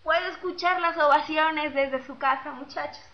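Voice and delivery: normal talking voice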